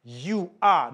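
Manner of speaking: "You are" punched out loud; preacher hammering "You are" at the believers; emphatic you are. Shown as a demonstration. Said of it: "It's emphatic. He says".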